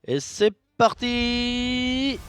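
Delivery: high, long-held "parti"; hyped-up start call; announcer's kickoff. Pretend. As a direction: launch it like a showman, drawing it out.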